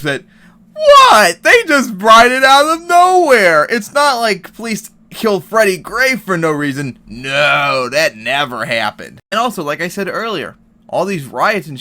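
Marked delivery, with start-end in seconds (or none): 0.7-7.0 high, loud, scornful mock outrage; mocking Fox News voice. Vlogger mocking Fox News pundits, high scornful outrage. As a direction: sneer it like a shocked pundit. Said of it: "especially on Fox News, they started promoting this belief".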